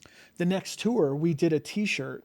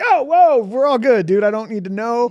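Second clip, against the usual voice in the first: much higher, much louder backpedal; retold alarmed protest; 7 semitones higher, 10 dB louder than usual. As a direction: stop him fast, alarmed but friendly. Kid starts confessing, he stops.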